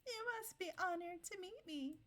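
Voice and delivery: high, lofty put-on voice; haughty character imitation. Repeats character's haughty line.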